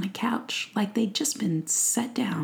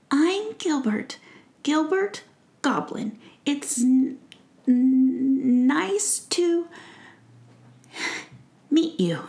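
slow, higher, stuttering goblin voice; shy stammering goblin; 7 semitones higher, 3 dB louder, slower than usual. Acted. Gilbert the goblin shyly introducing himself, stammering.

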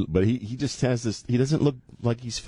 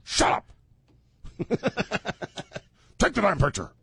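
bossy woman voice; 9 semitones higher than usual. Acted. Acting the fiancée.

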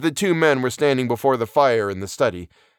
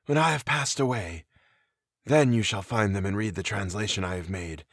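hushed, softer voice; whispered; 2 semitones lower, 5 dB quieter than usual. A narrator's reading. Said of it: "he whispered".

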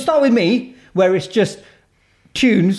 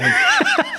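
high burst of laughter. Real, not acted. Laughing at the idea.

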